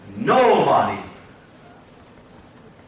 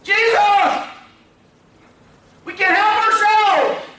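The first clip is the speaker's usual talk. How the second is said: desperate shout; 10 semitones higher, 3 dB louder than usual. Acted. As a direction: shout from afar, pleading with everything. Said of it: "They have to shout".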